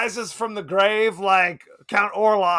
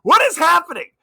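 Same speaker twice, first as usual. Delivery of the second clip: baffled disbelief; 5 semitones higher, 7 dB louder than usual. Retold or imitated. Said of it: "I'm like".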